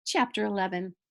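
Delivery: usual speaking voice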